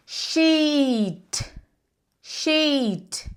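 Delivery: "sheet" with a long, stretched EE and falling tune; lengthened vowel; long I demo. Shown as a demonstration. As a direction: pull out the EE in "sheet". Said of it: "É alongado desse jeito mesmo".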